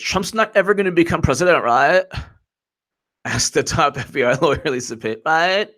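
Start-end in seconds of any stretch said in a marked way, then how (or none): 0.0-2.0 put-on higher-pitched Valley girl with rising "right?". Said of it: "she turned into a Valley girl". 5.3-5.7 put-on high, rising Valley girl "right?"; mock Valley girl uptalk. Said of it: "she turned into a Valley girl".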